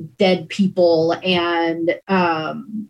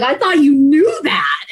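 surprised; 8 semitones higher, 5 dB louder than usual. Retold or imitated. Moved exchange with her sister.

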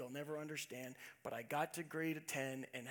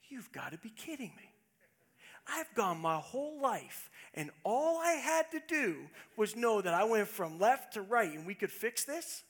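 disbelief; 5 semitones higher, 6 dB louder than usual. Retold or imitated. Retold disbelief at simple fix.

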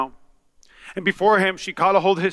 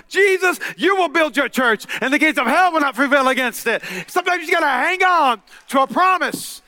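full-force preaching; 6 semitones higher than usual. Acted. Pastor rallies the church to hang on, full force. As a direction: preach it out to the congregation.

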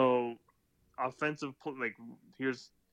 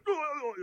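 high fake groans of pain; mock pained cries; 11 semitones higher, much faster than usual. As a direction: imitate a player flopping. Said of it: "He went".

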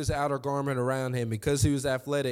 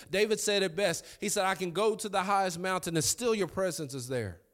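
preaching at full force; 5 semitones higher than usual. Acted. Pastor preaching to the church, building to full force. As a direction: build the sermon to a climax.

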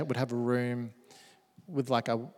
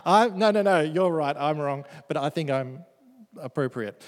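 ordinary talk, nothing marked

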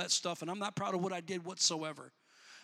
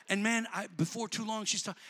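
ordinary talk

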